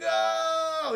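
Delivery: long, high held "oh"; mock cry of distress. Villain zapped by his own ray, drawn-out mock wail.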